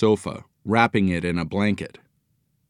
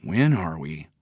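wondering; 5 semitones lower, 4 dB quieter than usual. A narrator's reading. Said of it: "Joe wondered".

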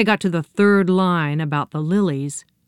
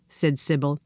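ordinary delivery, nothing marked

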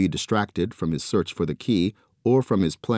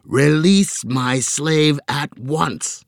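slow, louder monster command; commanding squid; 7 semitones higher, 4 dB louder, much slower than usual. A narrator's reading. Squid monster ordering Joe, slow and commanding. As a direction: order it like a tyrant.

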